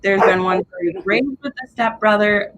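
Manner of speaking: normal talking voice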